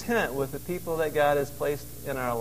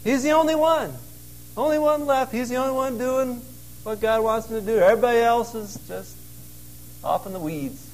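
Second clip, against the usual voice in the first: higher, sing-song mockery of self-pity; mocking self-pity; 6 semitones higher than usual. Preacher mocking Elijah's claim to be the only faithful one, higher pitched. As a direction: lampoon his "only one" whining.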